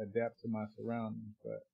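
ordinary talk, nothing marked

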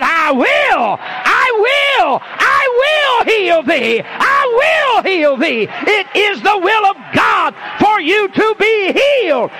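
preaching at full force. Acted. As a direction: hammer each repeat home. Climactic preaching.

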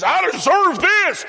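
high, slow, loud shout; mock society's loud entitled cry; entitled demand. Preacher mimics an entitled society to his congregation, entitled shout. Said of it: "Our society says".

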